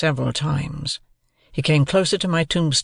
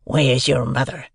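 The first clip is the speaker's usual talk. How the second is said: rough man's questioning voice; menacing convict. A narrator's reading. Convict asks the boy about family, menacing. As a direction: question the child as a threatening convict.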